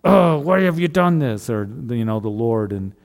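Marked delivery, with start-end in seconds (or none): 0.0-1.5 raised, loud accusing father; a scolding father, not a broken heart; mock harsh father. Pastor voicing an angry father to the congregation, loud accusing. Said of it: "a father that's, you know".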